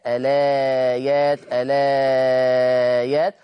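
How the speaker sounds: الآيات with the vowel stretched slowly; madd al-badal. Shown as a demonstration. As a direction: lengthen الآيات step by step. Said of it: "وكذلك الأمر في لفظ الآيات". (الآيات بتوسط وإشباع البدل)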